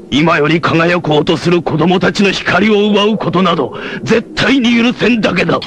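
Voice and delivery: climbing heated vow; righteous fury. Acted. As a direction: build up to a fierce refusal. Hero's indignant declaration.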